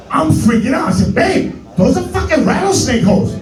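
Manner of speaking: high, panicked squeal; mock panic. Telling his girlfriend about rattlesnake holes, high panic. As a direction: squeal it in comic terror.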